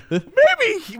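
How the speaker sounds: high-pitched put-on musing; mock wary hesitation. Crazy person sizing up the co-host, in a high mock-wary voice.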